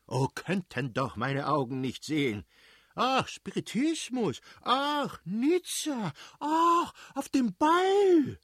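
very high-pitched, drawn-out mocking swoon; shrieky mock-feminine imitation, building in pitch and volume; mimicking his wife. A narrator's reading. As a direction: parody the wife's gushing with exaggerated sighing cries. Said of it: "da er seine Frau nachzuahmen meinte".

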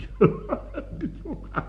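high, soft cackle; scoffing laugh. Acted. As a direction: laugh off the naive question. Laughs at the question who.

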